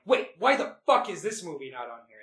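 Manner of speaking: baffled, punchy mock indignation; mock outrage. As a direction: demand it in exasperated disbelief. Acting out own bewildered reaction.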